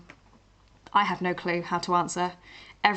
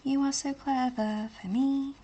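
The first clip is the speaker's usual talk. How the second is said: doting voice; 6 semitones higher, 4 dB quieter, slower than usual. Pretend. Doting mock praise.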